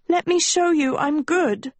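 high, urgent plea; distressed high-voiced appeal; desperate begging. A narrator's reading. Sophie begs the prince.

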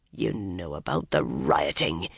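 low, muttered under the breath. A narrator's reading. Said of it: "he muttered".

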